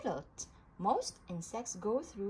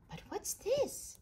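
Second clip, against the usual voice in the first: much higher, wondering what's this; surprised question; 12 semitones higher than usual. A narrator's reading. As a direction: sound puzzled and amazed. Surprise at newborns.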